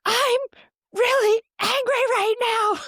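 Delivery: high, loud re-enacted outburst; angry yell. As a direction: yell it out like a parent who has snapped. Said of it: "I was able to yell something skillful".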